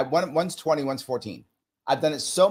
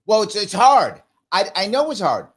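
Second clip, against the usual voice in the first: acted dialogue; 7 semitones higher, 5 dB louder than usual. Acted back-and-forth with trainee.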